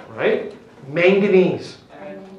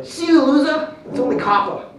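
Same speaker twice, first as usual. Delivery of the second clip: high, loud acted taunt, easing off at the end; taunting character; 8 semitones higher, 5 dB louder than usual. Robbed man goes to fleeing thief, acted loud taunt. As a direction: toss it out as a mocking send-off. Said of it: "he goes".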